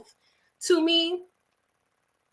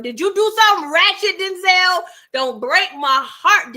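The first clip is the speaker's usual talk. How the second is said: loud, high, hammed-up accusation; mock alarmed plea; 9 semitones higher, 6 dB louder than usual. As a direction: plead loudly as if about to be betrayed. Mock pleading with Denzel.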